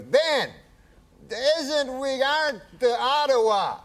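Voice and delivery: high, very slow Cosby drawl; Bill Cosby impression. Guest doing Cosby for the host, high and slow. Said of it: "and he goes".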